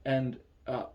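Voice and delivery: ordinary delivery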